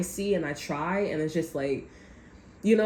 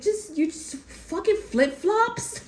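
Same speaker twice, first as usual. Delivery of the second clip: high, drawn-out disbelief tipping into a laugh; incredulous laughing; 9 semitones higher, slower than usual. Real, not acted. To someone wearing flip-flops in the cold, incredulous laughing. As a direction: question it slowly, pitched up and cracking up.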